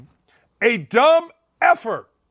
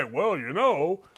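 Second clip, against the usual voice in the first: resigned Southern Indiana talk, pitched down and quieter; Southern Indiana accent; 5 semitones lower, 4 dB quieter than usual. Acted. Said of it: "they all talk like this".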